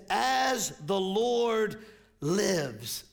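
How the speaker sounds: very slow, drawn-out words; solemn declaration. Acted. Preacher quoting David's oath to congregation, slow solemn declaration. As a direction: give every word its own weight.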